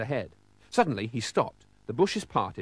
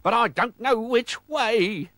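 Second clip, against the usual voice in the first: high, louder, drawn-out wail; pirate wail; 10 semitones higher, 5 dB louder, slower than usual. A narrator's reading. Captain has lost his way, slow high wail.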